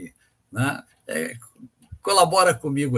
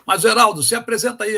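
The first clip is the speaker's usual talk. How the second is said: calling out; 8 semitones higher, 7 dB louder, faster than usual. Real, not acted. Calling to a frozen caller.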